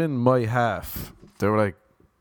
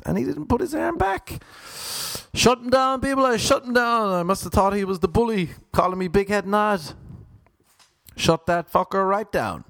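triumphant shout; 11 semitones higher than usual. Real, not acted. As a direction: crow about the win like a champ. Triumphant boast about armrest win.

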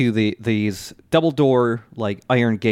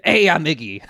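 quick Brooklyn greeting; light New York street accent; 3 semitones higher than usual. Retold or imitated. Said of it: "the narrator just says".

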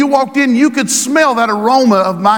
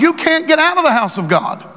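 normal talking voice

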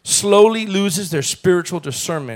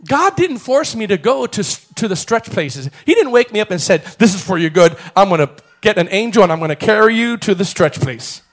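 louder testimony, pushed out with force; fervent preaching; 3 semitones higher, 6 dB louder than usual. Acted. Pastor telling the church about his own stretch place, fervent and loud. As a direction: tell your own story like a charge to the church.

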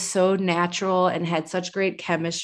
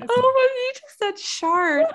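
high and slow, tearful laughing; squeezing words out through laughter; crying with laughter; slower than usual. Real, not acted. Laughing at friend's slip.

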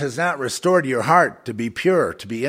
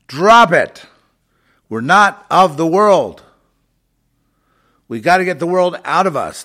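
forceful preaching; 8 semitones higher, 11 dB louder than usual. Acted. Preaching, commanding listeners.

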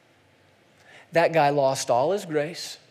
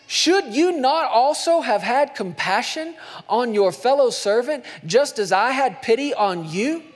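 stern rebuke; 5 semitones higher, 3 dB louder than usual. Acted. Master confronting the wicked servant, sternly. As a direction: scold him from on high.

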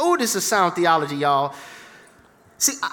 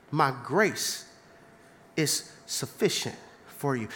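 low, soft, calm voice of God; God's calm reply; 8 semitones lower, 7 dB quieter than usual. Retold or imitated. God answering the pleading believer, low and calm. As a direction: answer gently and firmly. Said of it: "And he says".

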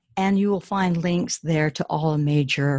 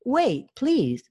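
higher, urgent plea; pleading prince; 8 semitones higher than usual. Acted. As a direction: plead with the ogre. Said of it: "said the prince again".

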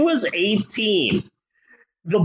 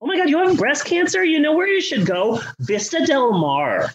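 giddy gossip; 6 semitones higher, faster than usual. Pretend. Barb and Star gossip.

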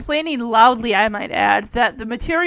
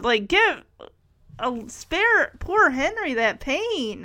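usual speaking voice

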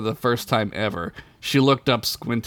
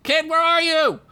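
calling out. Acted. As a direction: yell for the kid across the clearing. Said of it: "Ugla shouted".

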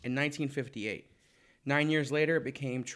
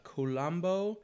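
normal talking voice

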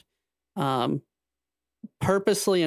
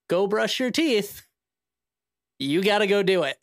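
nagging parent; 5 semitones higher than usual. Retold or imitated. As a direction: tell a kid to brush teeth. Parent voiced nagging child.

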